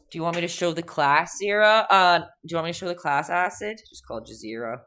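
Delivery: ordinary talk, nothing marked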